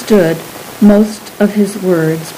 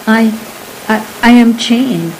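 plain reading voice

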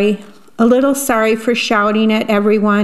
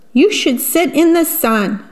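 high, lilting suggestion; encouraging storybook lemur; 7 semitones higher than usual. Acted. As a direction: lift the voice high to coax him. Lemur giving Jim advice.

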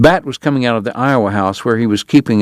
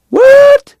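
sudden much higher, louder shocked yelp; stunned what; 8 dB louder than usual. A narrator's reading. Bat hearing the eighteen hundred dollars, shocked yelp. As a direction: jolt out the word in disbelief.

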